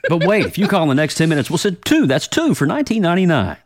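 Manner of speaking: fast, loud TV pitchman; infomercial. Acted. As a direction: hype the bonus offer. Infomercial parody, louder faster.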